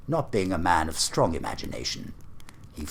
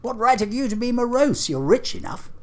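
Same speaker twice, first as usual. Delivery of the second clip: much higher, lively teasing retort; gaily; 13 semitones higher, 4 dB louder than usual. Acted. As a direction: toss it back, bright and teasing. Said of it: "returned the nephew gaily".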